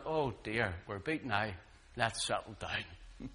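low, hushed, defeated aside; meek surrender. Acted. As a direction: back down softly after a beating. Beaten sea voiced as giving in.

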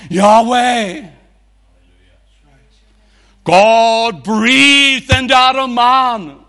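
building from firm to a loud high cry; preacher climbing in force to the name of God; preaching climax. Acted. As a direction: preach it up to a full roar.

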